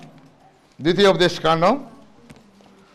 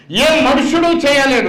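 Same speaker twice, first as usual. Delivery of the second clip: raised, higher cry; preaching outburst; 8 semitones higher, 4 dB louder than usual. Acted. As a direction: proclaim it loudly. Preaching climax.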